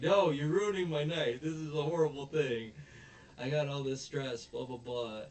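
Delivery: retold grumpy vice principal; imitating an upset vice principal; whiny complaint.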